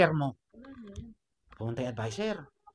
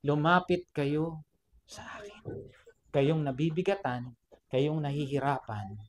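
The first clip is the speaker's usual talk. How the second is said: soft, slow, lowered scripture; gentle invitation; 4 semitones lower, 4 dB quieter than usual. Retold or imitated. Preacher repeating Jesus' words to the burdened, soft and gentle. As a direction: hush it into a tender call.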